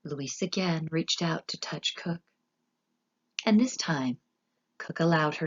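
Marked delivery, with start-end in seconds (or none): none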